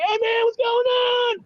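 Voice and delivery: very high, shouted party-guy hollering; shrill-pitched rowdy dude impression; party-dude Jesus. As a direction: holler to your buddies at the top of your range. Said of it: "probably was great. It's like".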